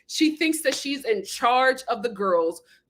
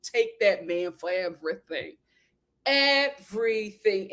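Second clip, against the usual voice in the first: usual speaking voice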